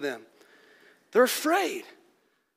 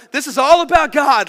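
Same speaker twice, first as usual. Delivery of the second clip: proclaiming; 3 semitones higher, 9 dB louder than usual. Acted. Angels proclaiming, preacher voicing them.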